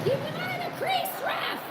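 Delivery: high yell from far off; yelling from the sidelines. Real, not acted. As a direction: shout it across the rink at the ref. Yelling at the ref from stands.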